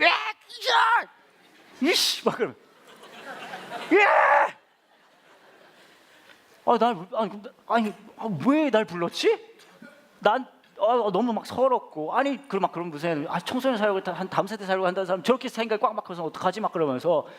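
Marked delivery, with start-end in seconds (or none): 0.0-0.1 high-pitched screech of pent-up anger; mock screeching. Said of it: "막 꾀꾀거렸고". 1.8-2.2 high, exasperated curse under pressure; mock screeching. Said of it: "막 꾀꾀거렸고". 2.2-2.5 high-pitched screech of pent-up anger; mock screeching. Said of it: "막 꾀꾀거렸고". 3.9-4.6 very high, loud furious shriek, hammed up; mock screeching. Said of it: "막 꾀꾀거렸고". 6.7-16.6 high-pitched, aggrieved venting; re-enacted angry rant. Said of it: "너무 화가 나가지고 막".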